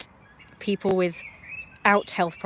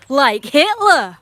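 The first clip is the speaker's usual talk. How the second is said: loud, high and slow; slow, emphatic yell; shouted punchline; 6 semitones higher, 10 dB louder, much slower than usual. Real, not acted. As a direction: shout it drawn out to drive the point home. Shouted punchline of political rant.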